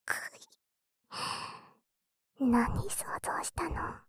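hushed teasing whisper; suggestive whisper. Acted. Sister whispers suggestive teasing to her flustered brother. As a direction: whisper the hint slyly.

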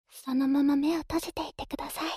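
ordinary talk, nothing marked

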